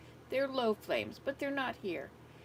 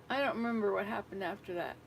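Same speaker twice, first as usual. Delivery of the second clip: ordinary delivery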